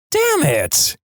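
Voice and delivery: high-pitched mock cry of frustration; exasperated outburst. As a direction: curse the trick in a sudden high burst. Comic cut-off exclamation of a read post.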